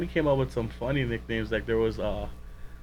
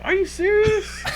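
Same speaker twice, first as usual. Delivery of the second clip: incredulous; 5 dB louder, slower than usual. Retold or imitated. Incredulous retold reply.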